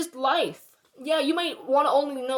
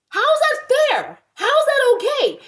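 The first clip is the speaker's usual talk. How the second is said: loud, very high-pitched outrage; indignant outcry; 13 semitones higher, 6 dB louder than usual. Real, not acted. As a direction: demand an answer, voice leaping up. Indignant rant about liability.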